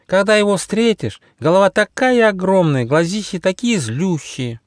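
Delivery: high, dramatic butterfly voice; wide-eyed, high little voice; butterfly character. Acted. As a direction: describe the monster in high-pitched alarm. Butterfly describes the scary beast.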